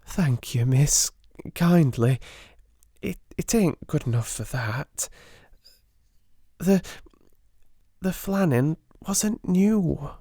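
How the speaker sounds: lightly voiced shy scullery maid, stumbling; cockney maid Becky. A narrator's reading. Becky explaining the gift to Sarah, timid.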